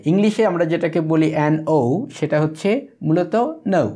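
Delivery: normal talking voice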